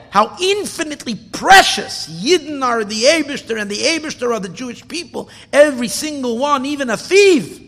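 loud impassioned sermon voice; impassioned preaching. Acted. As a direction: preach it with mounting passion. Preacher's climax.

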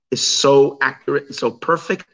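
ordinary talk, nothing marked

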